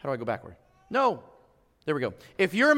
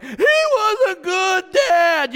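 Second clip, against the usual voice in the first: high, slow, loud emotional cry; choked-up praise; 10 semitones higher, 7 dB louder, much slower than usual. Retold or imitated. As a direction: voice breaking with feeling. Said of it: "I'm like".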